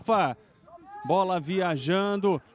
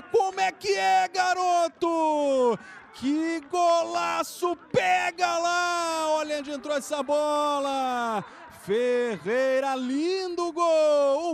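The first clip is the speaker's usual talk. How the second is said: excited shouting; 6 semitones higher, slower than usual. Real, not acted. Goal celebration shouting.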